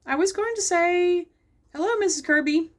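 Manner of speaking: put-on boy greeting his teacher in the park; Bobby's voice. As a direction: lift into a young boy's eager voice.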